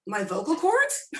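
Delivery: high, slow mock-innocent question; mock surprise. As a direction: act puzzled, drawing it out up high. Patient playing dumb with doctor.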